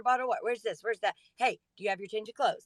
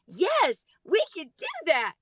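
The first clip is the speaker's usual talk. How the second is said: put-on eager kids; 8 semitones higher, 5 dB louder, slower than usual. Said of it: "they went".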